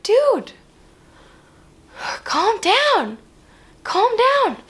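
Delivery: high-pitched, drawn-apart exasperated plea. Retold or imitated. Her to the still-yelling driver, mock exasperation. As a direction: plead in exasperation, pausing between phrases.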